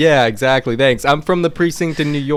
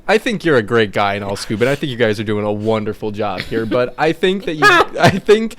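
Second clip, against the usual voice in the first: smooth-talking pitch, cracking up; detective charming the cop, breaking into laughter; charming, laughing; 4 semitones higher than usual. Acted. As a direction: flatter him through giggles. Player rolling charm on cop.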